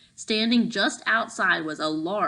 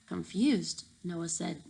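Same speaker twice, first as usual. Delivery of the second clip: ordinary delivery, nothing marked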